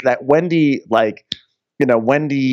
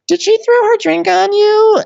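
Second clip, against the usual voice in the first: much higher and louder, put-on gasping question; shocked Ashley; 5 dB louder than usual. Ashley asking Wendy about the thrown drink, high put-on shocked voice.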